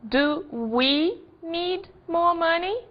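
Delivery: slow, careful model question; slow English example sentence. Shown as a demonstration. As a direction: say it slowly for learners. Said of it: "Mais um exemplo".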